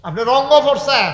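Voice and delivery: high, loud accusing burst; loud rebuke. Real, not acted. Preacher rebuking listeners lost in amusement, loud accusation. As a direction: throw the charge hard at them.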